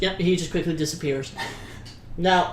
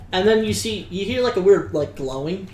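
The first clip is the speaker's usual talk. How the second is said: normal talking voice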